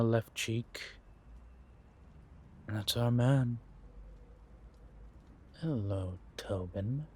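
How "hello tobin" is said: soft, hushed greeting to a watched target; quiet menace. Acted. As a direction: say it softly, as if only to yourself. Watching his target from afar.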